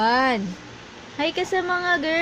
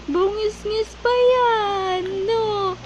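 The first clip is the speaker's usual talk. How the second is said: high, drawn-out cooing; 6 semitones higher than usual. Real, not acted. Cooing teasingly at giggling baby.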